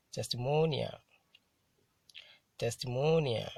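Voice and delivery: "testimonial" with MO hit harder; careful model reading of "testimonial", stressed on MO; penultimate stress. Shown as a demonstration. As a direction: punch MO in "testimonial", then fall. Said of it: "stressed on the penultimate syllable".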